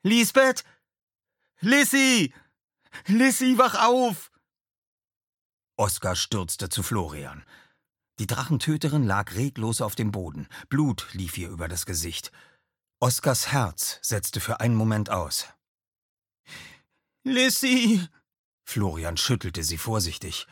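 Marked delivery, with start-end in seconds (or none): 0.0-4.3 much higher, louder panicked cries; panicked calling. Acted. Florian calls unconscious Lisbeth, high panicked shouts. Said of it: "Florians panische Stimme". 17.2-18.1 much higher, louder desperate cry; desperate call. Acted. Florian calls out to Lisbeth lying still, panicked cry.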